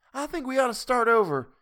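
ordinary delivery

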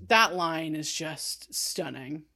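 hushed, fighting back tears; barely voiced, emotion catching; real choked-up near-whisper. Real, not acted. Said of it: "I'm trying not to, like, let you hear that I'm choking up".

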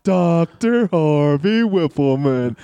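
slow, drawn-out ring announcer call with a hint of disdain; slow mock intro of a wrestler; disdainful announcer. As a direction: lightly imitate a sneering ring announcer. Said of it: "Just the disdain in his voice when he would announce him".

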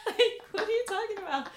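high, laughing disbelief. Retold or imitated. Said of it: "I was like".

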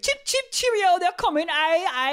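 high, hammed-up British town crier; Paul Revere. Rider warning the town of the British, mock cheerio yell. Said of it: "I'll tell you how he went".

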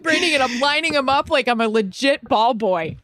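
high, breathless-sounding run of delight; giddy retold excitement. Retelling shagging balls for a football star, giddy excitement. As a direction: gush it high, reliving the thrill.

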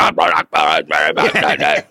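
deep shouted alien gargle; Akbar noise. Shown as a demonstration. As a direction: bark out a guttural alien yell. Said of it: "Akbar's quite similar".

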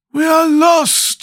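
very high, long-drawn doleful cry. A narrator's reading. Scarecrow sees the unfamiliar country at dawn, dolefully. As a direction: stretch it out into a high lament. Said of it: "said the Scarecrow dolefully".

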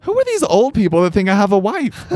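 high, bewildered retold thought; mock exasperated wonder; incredulous. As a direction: rise up in baffled disbelief. Retold inner reaction, bewildered.

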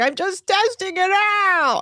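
high, drawn-out goofy slur; mock drunk guest. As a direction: stretch it out high like a tipsy joker. Imitating drunk guest's joke.

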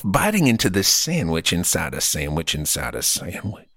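normal talking voice

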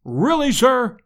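high-pitched, affronted exclamation; indignant cry. A narrator's reading. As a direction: protest as an offended professor. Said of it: "cried the professor".

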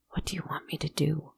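breathless frightened whisper. A narrator's reading. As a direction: whisper it, fast and scared. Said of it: "he whispered".

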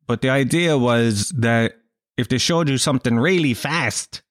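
ordinary talk

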